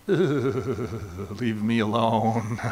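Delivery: slow, low mock groan of annoyance; disgusted brush-off. Preacher retelling his teenage self brushing Sue off. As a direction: brush her off with a mild ugh. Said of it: "And I'm, like, going".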